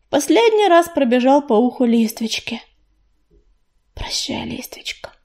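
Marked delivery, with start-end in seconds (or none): 4.0-5.1 very soft, hushed goodbye; hushed, voice almost vanishing; whispered farewell. A narrator's reading. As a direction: whisper goodbye to a close friend. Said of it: "прошептала она".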